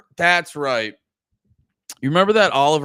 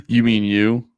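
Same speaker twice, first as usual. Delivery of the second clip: ordinary talk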